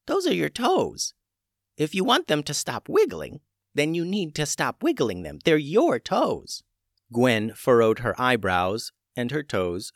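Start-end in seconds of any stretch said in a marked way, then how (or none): 0.1-6.6 dry witch voice. A narrator's reading. Witch Tubes dry answer.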